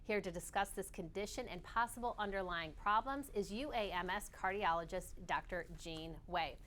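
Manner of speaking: bright broadcast intro; TV host read. Acted. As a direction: introduce the segment on air. Host's segment intro.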